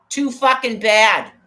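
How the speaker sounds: Too fucking bad, loud and slow; furious slow yell. Yelling at the man on the street, slow and loud. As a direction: hammer it out at full volume.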